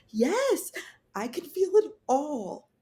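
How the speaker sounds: high, urgent yes; desperate insistence. Retold or imitated. Patient telling doctors she feels it, high and urgent. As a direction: insist you feel everything.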